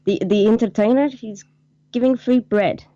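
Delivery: mock Spanish accent on the phone. Marie phoning reception about free bread, mock Spanish accent. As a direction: play a flustered Spanish receptionist caller. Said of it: "imagine Marie phoning up to reception".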